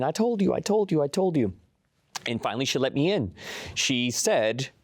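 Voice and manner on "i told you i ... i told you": high, excited retold scream at moderate volume; pitched-up triumphant repeating, imitating a scream; mock screaming. Woman to her doubting friend, mock screaming vindication. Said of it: "and she was screaming".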